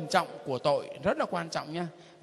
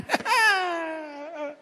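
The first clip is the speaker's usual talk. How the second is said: hearty laugh; 9 semitones higher than usual. Real, not acted. Laughs at own mockery.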